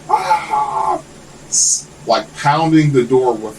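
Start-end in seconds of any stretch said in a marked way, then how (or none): 0.1-1.0 very high, crazed scream; high mock shriek. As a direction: imitate an unhinged neighbor screaming. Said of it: "bro, she was like".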